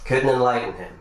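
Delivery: spoken word poem, rising, rhythmic delivery; slam poetry recital. Acted. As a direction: spit the rhymes like a poetry slam.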